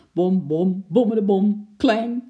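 loud bom-bom rising to a clang; imitated thumping clatter. A narrator's reading. Storyteller imitating noises the man hears, loud thumps and clang.

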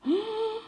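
high shocked gasp; second stunned gasp. A narrator's reading. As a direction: gasp in disbelief. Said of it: "en toen nog eens". (ontzet naar adem happen)

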